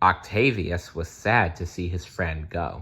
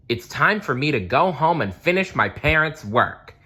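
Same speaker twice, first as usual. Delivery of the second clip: mild mock shout; 7 semitones higher than usual. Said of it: "Nicholas shouted".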